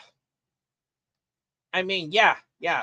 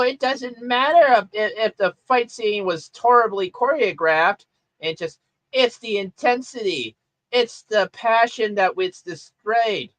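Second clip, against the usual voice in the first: high-pitched mocking voice of a defensive fan; mock gushing fan; 7 semitones higher than usual. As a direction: imitate fans pleading their case in a silly high voice. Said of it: "a lot of people are like".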